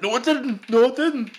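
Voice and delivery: high-pitched mimicry of a defensive friend; mocking denial. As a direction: whine it back in a mocking high voice. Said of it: "And you're like".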